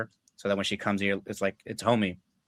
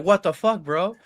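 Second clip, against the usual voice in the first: loud incredulous outburst, higher; loud shocked what the fuck bro; 9 semitones higher, 4 dB louder, slower than usual. Retold or imitated. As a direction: blurt it out in disbelief.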